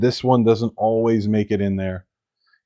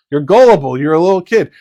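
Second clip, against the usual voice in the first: loud, higher-pitched mock outburst; mock exclamation; 4 semitones higher, 8 dB louder than usual. Preacher voicing an instant reaction to hurt, mock exclamation. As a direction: exclaim it loudly with raised pitch.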